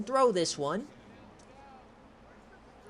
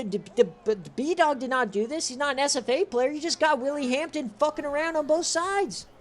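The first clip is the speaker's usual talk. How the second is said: ordinary talk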